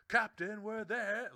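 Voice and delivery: high, loud call-out to the captain; role-play call to captain. Acted. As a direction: holler it like a sailor hailing the captain. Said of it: "we're role playing".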